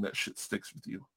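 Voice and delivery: hushed, breathy whisper. Real, not acted. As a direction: drop to a whisper for emphasis. Emphatic point about hurtful words.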